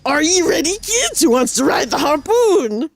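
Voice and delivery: mild pirate, slow last line; half-hearted pirate call. Acted. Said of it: "I can't do a pirate voice, guys. But I tried".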